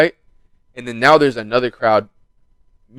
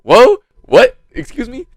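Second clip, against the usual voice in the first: high startled whoa; shocked; 10 semitones higher, 6 dB louder, slower than usual. Retold or imitated. Him reacting to the girls' insult, high and startled. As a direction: jump up in pitch, stunned.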